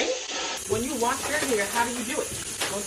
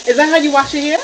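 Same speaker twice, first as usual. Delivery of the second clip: incredulous; 4 semitones higher, 9 dB louder than usual. Real, not acted. Mom scolds rough hair washing.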